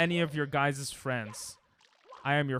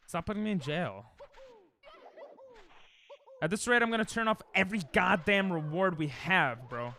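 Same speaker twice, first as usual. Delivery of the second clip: normal talking voice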